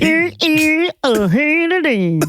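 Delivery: mocking, throat-stuffed accent imitation; high, strangled nonsense syllables; cantaloupe in throat. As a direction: imitate someone talking round a blocked throat. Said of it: "has a cantaloupe stuck in their throat".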